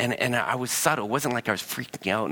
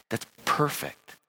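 slow, breathy near-whisper That's perfect; hushed approval; 5 semitones higher, slower than usual. Real, not acted. Preacher hushes his voice to praise the ideal soothing response. Contrasting repeat of the phrase.

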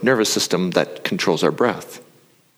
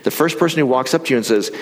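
ordinary talk, nothing marked

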